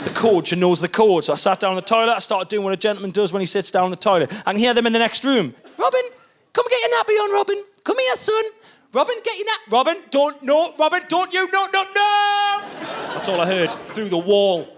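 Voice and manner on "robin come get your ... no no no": high frantic parent, acted out; alarmed calling. Parent chasing a nappyless toddler next door, mock alarm. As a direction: call the child, rising into panicked pleading. Partner heard panicking through the wall.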